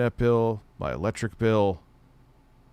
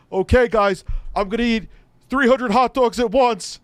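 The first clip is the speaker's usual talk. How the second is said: much higher and louder, a hyped announcement; Frankie McDonald impression; 13 semitones higher, 8 dB louder than usual. Imitating a vlogger announcing a stunt, high and loud. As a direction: pitch it up like a proud challenge video. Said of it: "Frankie McDonald".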